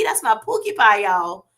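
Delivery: normal talking voice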